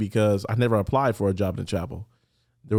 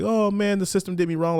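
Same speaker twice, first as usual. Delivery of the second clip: mock whining; 8 semitones higher than usual. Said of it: "the guy crying like".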